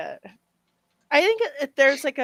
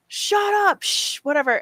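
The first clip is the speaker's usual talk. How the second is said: mock shushing crowd; 10 semitones higher, 5 dB louder than usual. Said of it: "everyone's like".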